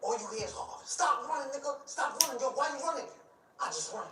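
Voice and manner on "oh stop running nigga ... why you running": high, strained call to stop running; retold shout, high and straining; mock yell from the chasers. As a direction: pitch it way up like someone chasing you down the street. Chasers shouting after him.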